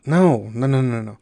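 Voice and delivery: usual speaking voice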